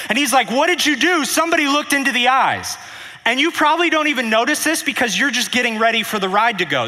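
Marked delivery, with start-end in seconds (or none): 0.5-2.8 panicked. Retold or imitated. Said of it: "Sala comes on more panicked".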